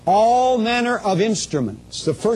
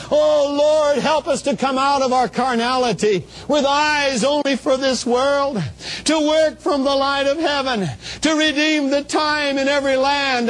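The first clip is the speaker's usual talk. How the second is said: crying out; 6 semitones higher than usual. Retold or imitated. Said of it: "and she's crying out".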